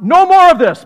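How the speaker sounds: sudden sharp command. Acted. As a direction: cut it off with a quick loud order. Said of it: "He says, uh".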